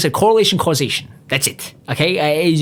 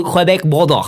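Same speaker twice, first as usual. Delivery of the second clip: put-on muddled Quebec accent; 3 semitones higher than usual. Comic rambling about French Canada, in a muddled Quebec accent. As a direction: attempt a Quebec accent and miss. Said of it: "what fucking accent that was".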